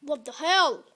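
loud startled outburst; startled shout. Real, not acted. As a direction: blurt it out in shock. Surprise in game.

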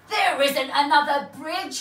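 a troll finding only sea; dismayed troll. A narrator's reading.

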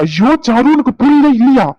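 frustrated uncle raising his voice; exasperated outburst. Acted. (எரிச்சலுடன் உரத்த குரல்)

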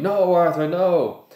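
high, loud panicked shriek. A narrator's reading. Wife shrieks at her husband to stop fighting, loud. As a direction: scream at him to stop. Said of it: "Missus Weasley was shrieking".

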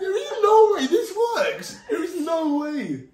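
high, incredulous protest with laughter; laughing disbelief. Real, not acted. Refuses to believe it worked.

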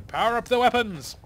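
high, loud battle order; loud command. Acted. Dramatic reading of event text.

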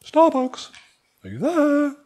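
high, far-off hailing voice; phone calling out. Retold or imitated. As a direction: play the lonely phone calling for its Wi-Fi. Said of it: "sending something out as you walk saying".

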